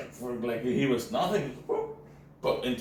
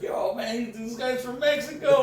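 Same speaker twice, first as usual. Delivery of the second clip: imitated cop, high; 12 semitones higher than usual. Cop calling other cops.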